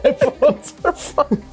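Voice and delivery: high, slow squeaky parody; squeaky mock voice. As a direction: stretch it out high and silly. Mocking repeat of the line.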